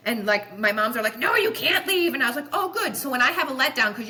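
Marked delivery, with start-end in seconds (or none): 1.2-2.1 high, loud mock protest; protesting moms. Moms hearing their doctor might quit, loud mock protest. Voices her patients begging her to stay. 2.5-3.0 pitched well above usual voice; high-pitched retold reply. Doctor answering her protesting moms, high-pitched acted reply.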